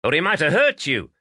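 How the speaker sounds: high, teasing jab at a friend; voiced Irish-ish friend, lightly teasing; mocking quip. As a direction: rib him with a grin in the voice. Daly mocks injured farmer.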